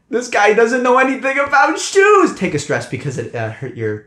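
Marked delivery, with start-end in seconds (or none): 0.1-2.3 high, loud scoffing guard. Acted. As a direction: sneer at the shoes. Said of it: "he's just like".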